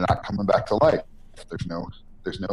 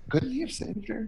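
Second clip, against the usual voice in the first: higher, whispered question to yourself; whispered doubt; 6 dB quieter than usual. Retold or imitated. Viewer quietly questioning Walter's choice, in a high whisper. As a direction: whisper it like a troubled thought.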